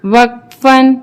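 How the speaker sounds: slow, measured chant with long held vowels; Qur'anic spelling recitation. Shown as a demonstration. Teacher spelling verses for learners, measured chant. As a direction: intone each letter, then blend it.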